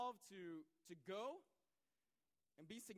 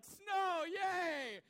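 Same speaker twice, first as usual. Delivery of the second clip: high, loud cheer of delight; excited squeal; 10 semitones higher, 13 dB louder than usual. Retold or imitated. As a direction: squeal like a kid seeing snow. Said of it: "I was all excited".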